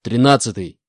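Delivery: loud barked call; barked order. Acted. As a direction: bark the soldier's number. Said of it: "рявкнул Буран".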